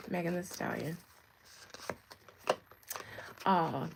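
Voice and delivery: ordinary talk, nothing marked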